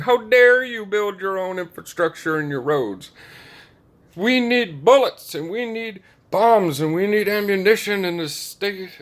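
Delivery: hammed-up imitation yelling demands; mock yelling Zelensky. Zelensky demanding weapons from Americans, mock high yell. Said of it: "basically yelling at all the American people".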